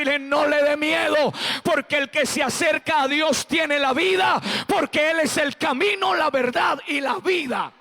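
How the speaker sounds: rising to a shouted, high preacher's call; preaching. Acted. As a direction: build the sermon up to a loud, high climax. Pastor preaching at full force.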